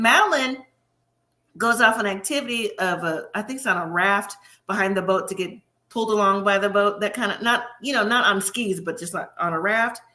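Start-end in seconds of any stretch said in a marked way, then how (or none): none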